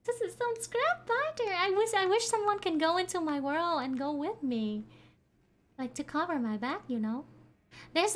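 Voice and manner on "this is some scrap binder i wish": ordinary delivery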